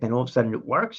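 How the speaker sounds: ordinary delivery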